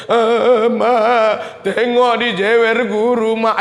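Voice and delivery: slow whiny wail to mama; drawn-out, hammed-up sobbing tattle; mock crying child. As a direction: imitate a child bawling a complaint. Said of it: "si kita aja nangis".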